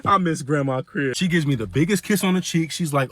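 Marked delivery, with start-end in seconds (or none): none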